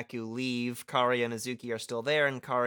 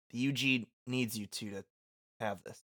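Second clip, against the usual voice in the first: halting, oddly broken delivery; awkwardly paused reading; weirdly read line; 4 semitones lower, 7 dB quieter than usual. Acted. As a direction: stumble oddly through the line, dropping off softer. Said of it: "I read that so weird".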